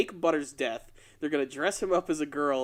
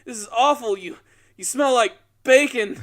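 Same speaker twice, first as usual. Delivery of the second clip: mock grieving father; 6 semitones higher, 7 dB louder than usual. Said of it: "picks up what he thinks is the corpse of his son".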